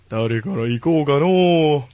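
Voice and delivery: slow, lightly voiced old man musing; mock old man. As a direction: drag it out like a playful old grandpa. Playful old-man wording after plain take.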